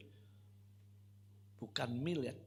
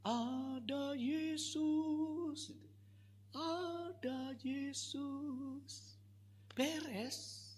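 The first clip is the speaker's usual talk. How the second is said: high, very slow drawn-out proclamation; slow emphatic preaching; 11 semitones higher, 3 dB quieter, much slower than usual. Acted. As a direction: stretch every word like a sermon climax. (khotbah lambat dan tinggi penuh penekanan)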